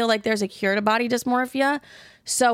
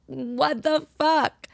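slow, higher-pitched mock dismay; drawn-out disbelief; 6 semitones higher, much slower than usual. Woman reliving bad body-image days in the mirror, drawn-out dismay. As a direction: stretch each word out, pitched up in disbelief.

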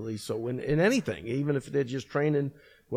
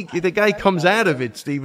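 ordinary talk

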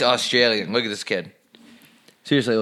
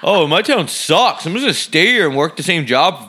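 a lightly hammed-up stuck-in-town local; a touch of a dopey small-town voice; mocking small-towner; 5 semitones higher, 3 dB louder than usual. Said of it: "they're too stupid to move".

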